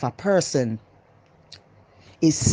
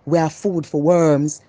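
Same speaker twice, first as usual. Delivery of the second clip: worm voice; slower than usual. Pretend. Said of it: "Worm now go say".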